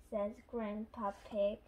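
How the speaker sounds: usual speaking voice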